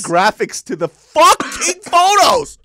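high, loud, slow indignant cry; loud exasperated yell; outraged. Real, not acted. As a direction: disbelief at the edits. Outraged at dad's edits.

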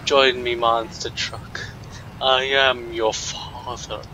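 put-on British-sounding dark lord; Darth Vader. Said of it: "Darth Vader wasn't British".

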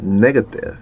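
"Negative." with the final t pressed hard, almost a Korean ㄸ; "Negative." clipped with a hard tense t at the end; tensed final t. Shown as a demonstration. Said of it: "끝에 트 발음이 약간 쌍디귿 비슷하게 납니다".

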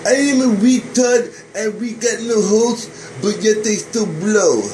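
loud, punchy show-host intro. Acted. Host opens his wake-up show, loud and punchy. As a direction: announce the show like a wacky host.

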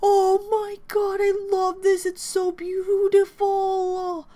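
overwhelmed fan adoration; gushing. Real, not acted. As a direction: gush it high, stretching the end. Gushing fan reaction to the photo.

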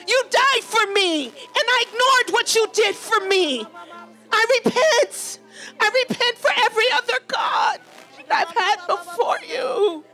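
pleading cry of confession, rising in pitch; heartfelt repentance. Shown as a demonstration. Preacher models repentance to Jesus for congregation, heartfelt pleading. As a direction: confess as to someone deeply offended. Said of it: "Talk to him like somebody you offended".

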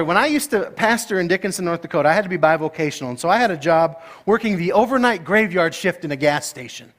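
ordinary talk

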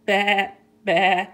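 loud imitated sheep baa; sheep bleat. A narrator's reading. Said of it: "Little Sheep bleats".

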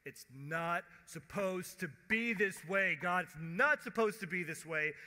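loud, insistent protest; crying out to God. Acted. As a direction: protest forcefully, leaning on each word. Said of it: "we're going".

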